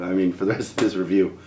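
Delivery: usual speaking voice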